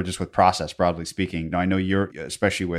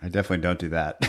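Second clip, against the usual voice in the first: ordinary talk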